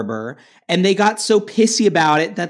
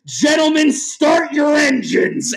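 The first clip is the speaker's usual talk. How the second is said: higher, shouted race start, engines drawn out slow; announcer shout; 7 semitones higher, slower than usual. Pretend. A podcaster imagining himself announcing at the Indy 500, shouting into the mic.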